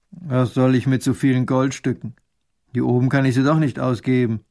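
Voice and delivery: put-on loud, grumpy old recluse; stubborn old mountain man. A narrator's reading. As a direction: refuse the gold obstinately and loudly.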